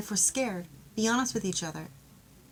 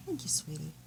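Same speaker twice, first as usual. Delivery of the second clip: whispered thanks; 4 semitones lower, 6 dB quieter than usual. Shown as a demonstration. Tender thanks to partner.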